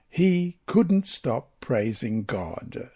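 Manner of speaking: ordinary delivery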